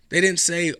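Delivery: ordinary talk, nothing marked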